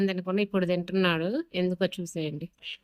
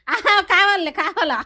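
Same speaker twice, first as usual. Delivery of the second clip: much higher, louder playful coo; 10 semitones higher, 4 dB louder than usual. Real, not acted. Baby talk to dog. (కుక్కతో ముద్దుగా పై స్వరంలో మాట్లాడటం)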